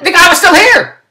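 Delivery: high, drawn-out cry of disbelief; exasperated outburst. Retold or imitated. Exasperated climax of the cable story.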